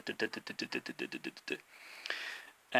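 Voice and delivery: soft, evenly spaced "duh" beats; syllable-timed rhythm. Shown as a demonstration. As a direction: tap out even "duh" syllables quietly. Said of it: "Polish is a lot more like".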